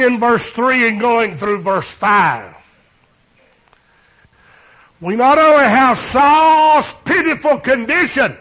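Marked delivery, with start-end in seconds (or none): 5.0-8.4 loud, high sermon delivery; fervent preaching. Acted. Preacher to congregation, loud fervent preaching. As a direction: lift it up to the congregation.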